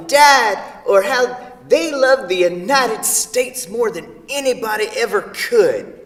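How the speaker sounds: high, loud, exasperated rant; mocking rant. [name] rants to Sarah about country music, high and loud. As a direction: rail against country music.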